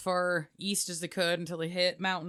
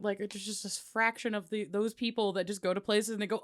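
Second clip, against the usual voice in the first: ordinary delivery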